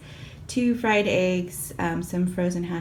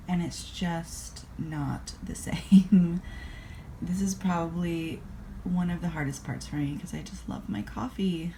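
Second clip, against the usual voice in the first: soft, much quieter whispered mourning over coffee; whispered lament; 7 dB quieter than usual. Real, not acted. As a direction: grieve your latte in a hush. Lamenting lost latte.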